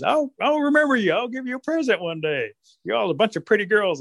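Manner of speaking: high, lilting rabbit voice; butter up the girls in a high pitch; flattering trickster rabbit. Acted.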